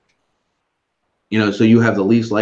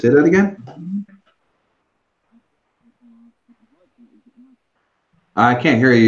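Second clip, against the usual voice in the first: raised, higher voice asking to repeat; to someone hard of hearing; 10 semitones higher than usual. Real, not acted. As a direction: call out over a bad line. Can't hear the caller on stream.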